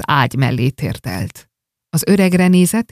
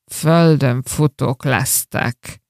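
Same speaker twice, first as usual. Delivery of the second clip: slow, drowsy, lower mumble; dazed mutter; 3 semitones lower, slower than usual. Acted. Drunk miser slowly mumbling a curse at his family. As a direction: mumble it half-asleep, drunk. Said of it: "bódultan motyogta".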